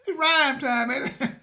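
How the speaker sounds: proud, laughing announcement; gleeful exclaim. Real, not acted. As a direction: crow it out like you just nailed a rhyme. Pleased with his own rhyme.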